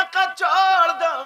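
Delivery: loud, high burst in the sermon; preacher suddenly rises up loud. Acted.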